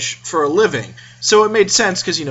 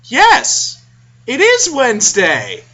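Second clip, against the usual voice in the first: put-on innocent little kid; 8 semitones higher, 4 dB louder, much slower than usual. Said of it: "he looks up from the table and he says".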